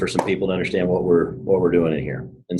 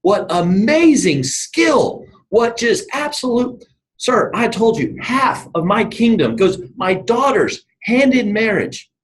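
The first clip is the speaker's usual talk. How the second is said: high, slow, effusive royal praise; impressed king; 12 semitones higher, slower than usual. Retold or imitated. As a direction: gush slowly like an awestruck king. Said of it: "the king's looking, it's like".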